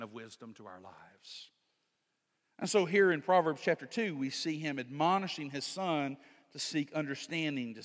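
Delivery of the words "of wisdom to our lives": hushed, unvoiced aside; whisper. Real, not acted. Preacher whispering the point to his congregation. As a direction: let it fall to a whisper for weight.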